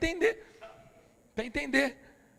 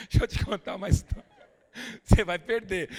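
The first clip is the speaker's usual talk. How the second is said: falando entre risadas; rindo; 2 semitones higher, 5 dB louder than usual. Real, not acted. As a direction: segura o riso enquanto fala. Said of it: "agora eu tô rindo". (falando rindo)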